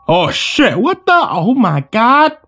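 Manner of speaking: stunned outcry, pitch jumping high; shocked exclamation. Acted. Group reacts to a sudden gunshot, shocked exclamation. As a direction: exclaim in drawn-out horror. Shock after the gun goes off.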